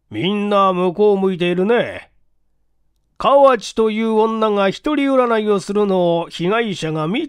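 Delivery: a questioning detective, lightly voiced; police inspector voice. The inspector grilling the building clerk, in a stern questioning voice. Inspector reasoning about the cards.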